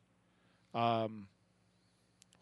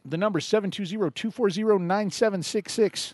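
higher, louder radio announcer read; 9 semitones higher, 5 dB louder than usual. Acted. Host gives listeners the call-in number, announcer style.

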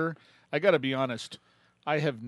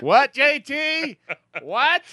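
much higher, louder, slow mock yell; mock shout; 10 dB louder, much slower than usual. Host jokingly yells at his co-host like a deaf old man. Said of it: "I'm like".

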